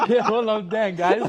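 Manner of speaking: laughing along. Real, not acted.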